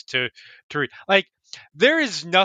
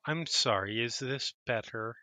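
quiet inner voice; 4 semitones lower, 10 dB quieter than usual. Acted. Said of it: "you hear in your head".

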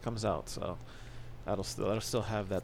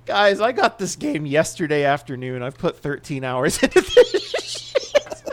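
loud, high laughing confession; 5 semitones higher, 13 dB louder than usual. Real, not acted. Laughing confession of thirteen hours.

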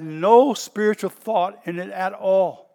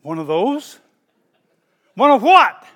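sudden high, loud disbelief; startled; 4 semitones higher, 10 dB louder, slower than usual. Retold or imitated. Pastor replaying his shocked reply to the young lady. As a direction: jump in surprise at the question.

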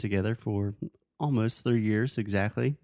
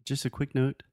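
ordinary talk, nothing marked

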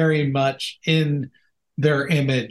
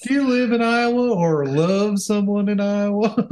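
cheesy ad announcer; 5 semitones higher than usual. Pretend. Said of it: "if this is how we talk".

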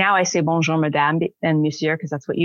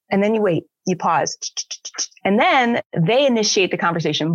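ordinary delivery

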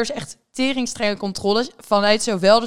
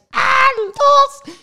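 very high, loud shrieking laughter; father's screech; 4 dB louder than usual. Retold or imitated. Father screeching at daughter over ID check, shrieking with laughter. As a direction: squeal it, doubled up laughing. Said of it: "die begint te krijsen".

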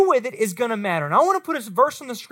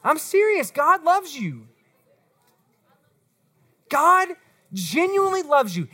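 ordinary delivery

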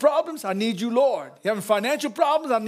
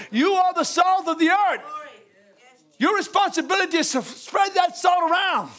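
preaching shout; 5 semitones higher than usual. Real, not acted. Preaching climax to congregation.

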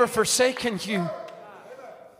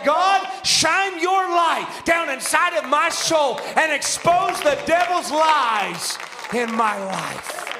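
slow fervent prayer; 3 semitones higher, slower than usual. Acted. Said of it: "Let the Holy Ghost say".

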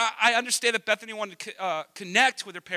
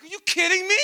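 high, loud incredulous question; loud disbelief; 11 semitones higher, 7 dB louder, faster than usual. Retold or imitated. Father reacting to son's outburst, acted loud disbelief. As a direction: act out being flabbergasted.

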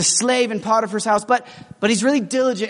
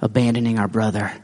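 scheming brother; 10 semitones lower than usual. Acted. Brothers plotting against Joseph.

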